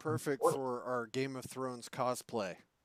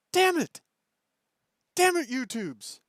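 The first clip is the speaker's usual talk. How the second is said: high, loud frustrated outburst, falling away; exasperated cursing; 10 semitones higher, 5 dB louder than usual. Real, not acted. Host cursing the frozen video stream, exasperated. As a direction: curse the glitch in a raised, pitched-up voice.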